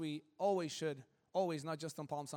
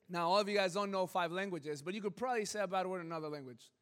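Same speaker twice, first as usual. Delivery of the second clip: ordinary talk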